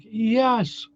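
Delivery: high, drawn-out elated yes. Retold or imitated. Patient retelling the doctors' go-ahead, elated.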